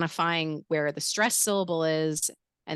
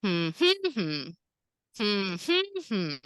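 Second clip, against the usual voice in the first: hummed stress pattern, higher middle note; humming; 3 semitones higher, slower than usual. Shown as a demonstration. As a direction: hum three beats, raising the middle one. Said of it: "You can hum".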